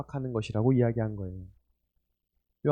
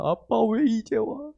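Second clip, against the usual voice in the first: put-on high, slow whimpering child's plea; crying son; 8 semitones higher, much slower than usual. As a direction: mimic the sobbing boy calling his dad. Crying son voiced by father. (우는 아들 흉내, 높고 느리게)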